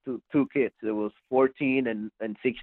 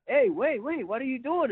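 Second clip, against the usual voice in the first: much higher, retold panicky protest; alarmed; 12 semitones higher than usual. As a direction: imitate someone backing away from a fight. Retold kid alarmed at challenge.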